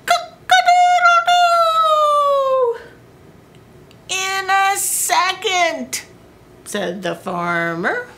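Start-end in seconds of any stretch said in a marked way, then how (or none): 0.1-2.8 high, drawn-out cock-a-doodle-doo; a rooster waking the farm; rooster crow. Acted. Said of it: "the rooster crowed". 4.1-6.0 high, groggy farmer voice; sleepy farmer. Acted.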